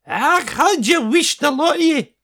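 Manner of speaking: high Scottish voice of the eccentric painter; high-pitched Scottish voice. A narrator's reading. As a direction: cry out a Highland greeting, high and bright. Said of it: "who had an unusually high-pitched voice".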